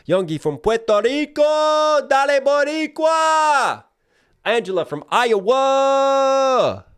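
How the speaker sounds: high, loud, stretched-out hype call; hyped shout-out. Real, not acted. Streamer hyping viewers in chat with a high, loud shout. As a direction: belt the names out like an arena announcer.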